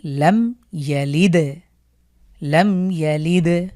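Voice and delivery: লাম ইয়ালিদ very slow, দ bounced; slow recitation, bounced দ. Shown as a demonstration. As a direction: recite slowly, jolt the final দ. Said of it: "তাহলে এটি আবারও পড়ি".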